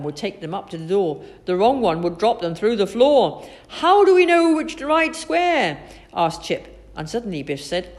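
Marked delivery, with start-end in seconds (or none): 3.7-5.8 high, loud, slow question; urgent question. A narrator's reading. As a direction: ask in loud bewilderment. Chip puzzles over dangerous squares.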